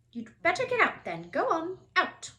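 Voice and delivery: shooing out. A narrator's reading. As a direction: chase the boy out. Librarian chasing Harry out.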